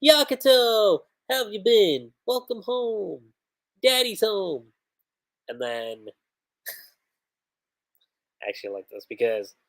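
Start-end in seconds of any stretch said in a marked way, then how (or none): 0.0-4.7 teasing father, pitched higher and drawn out slowly; cheeky father greeting his son; cheeky dad. Retold or imitated. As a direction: play it smug and slow like a cocky dad coming home. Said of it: "then he gets kinda cheeky about it".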